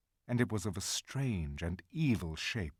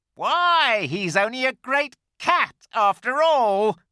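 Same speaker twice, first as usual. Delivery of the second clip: high, loud mocking creature voice; a creature mocks the shorn lion; jeering creature; 13 dB louder than usual. A narrator's reading. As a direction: cry out in scornful glee. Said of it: "cried one".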